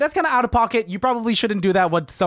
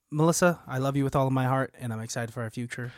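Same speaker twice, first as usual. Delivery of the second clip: mock sincere; 5 semitones lower, 5 dB quieter than usual. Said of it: "anything you wanna say to Melissa".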